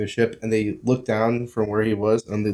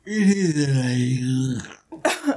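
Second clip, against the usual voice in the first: drawn out; 3 semitones higher, much slower than usual. Real, not acted. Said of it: "You good?".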